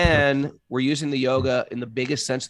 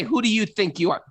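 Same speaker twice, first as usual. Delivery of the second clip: indignant retort; 9 semitones higher, faster than usual. Retold or imitated. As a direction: throw it out, offended and entitled. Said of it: "Whenever I say".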